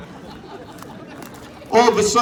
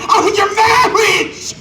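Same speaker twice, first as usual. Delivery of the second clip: much higher, slow hammed-up wail; mock anguished cry; 5 dB louder, slower than usual. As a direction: ham up a heartbroken cry. Mock wail over parents' divorce.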